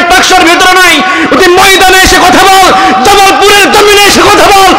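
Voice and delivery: high, shouting dare to the critic; shouted challenge. Acted. Preacher daring critics to come to the field, angry shout. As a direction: throw down the gauntlet, shouting. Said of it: "আমি বলছি".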